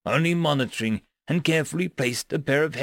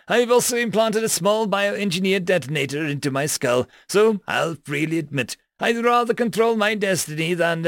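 higher, cocky boar creature; smug boar alien; 6 semitones higher, 3 dB louder than usual. Acted. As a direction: own your fate, cocky. Porcine alien admits detonator.

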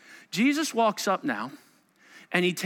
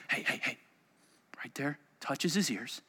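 hushed storytelling; 5 semitones lower, 9 dB quieter than usual. Acted. Acting out Jesus healing softly.

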